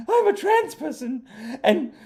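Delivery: high, loud, slow indignant falsetto with laughing sobs; hammed-up wounded outrage; mock outraged woman. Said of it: "and then she goes".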